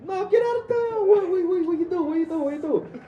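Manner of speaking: imitated high, loud New York yell; wildly excited New Yorker, voice shot way up; excited accented stranger. Subway stranger amazed at meeting voice actors, excited accented shouting. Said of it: "people with that strong of an accent".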